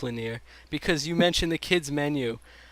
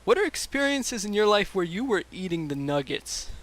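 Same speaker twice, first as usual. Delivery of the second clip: usual speaking voice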